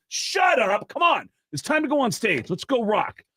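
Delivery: high-pitched, dismissive bandmate; brushing off teasing. Acted. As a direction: wave off the joke in a high, hurried-up protest. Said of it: "He thinks the band is messing with him".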